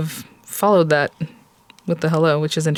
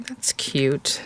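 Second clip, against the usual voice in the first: hushed little whisper of delight; whispered admiration over the finished card; 3 semitones lower, 6 dB quieter than usual. Real, not acted. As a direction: breathe it out in a whisper. Crafter admiring her card.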